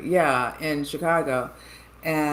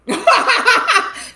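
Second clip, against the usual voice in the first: high, loud laugh; burst of laughter; 12 dB louder than usual. Real, not acted. Bursts out over her own story.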